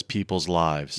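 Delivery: slow deadpan movie line. Retold or imitated. Mocking her grave reply, slow and low. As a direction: read it low and dead serious.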